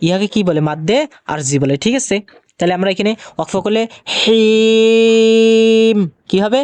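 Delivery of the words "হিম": "হিম" with the ee held very long, closing on m; sustained, chanted "হিম" at a pause; three-count elongation. Shown as a demonstration. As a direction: stretch "হিম" on a steady note before stopping. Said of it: "তিন আলিফ টানিয়ে পড়িতে হয়".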